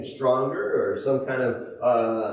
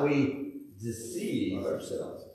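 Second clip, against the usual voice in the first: slow and soft; 5 semitones lower, 8 dB quieter, much slower than usual. Acted. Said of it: "slow soft scripture reading".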